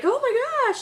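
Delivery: high-pitched, thrilled parent gush; positive response. Retold or imitated. Parent responding to baby's first mama, excited gushing. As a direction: gush up high in mock delight. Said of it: "you respond to it positively".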